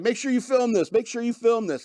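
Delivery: high-pitched calling out, no real volume; mock shouting. Host imitating an arrestee shouting to people around, mild mock shout. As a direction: imitate someone calling to bystanders, pitched well up. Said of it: "they're shouting to people".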